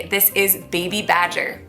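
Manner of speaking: ordinary delivery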